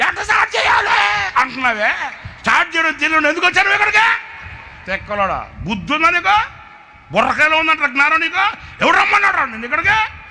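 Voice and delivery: furious high shouting rant; angry scolding. Retold or imitated. Preacher scolding the boy in a rage, shouting high. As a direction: tear into him at the top of your voice. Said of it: "కోపం వచ్చి తిట్టా".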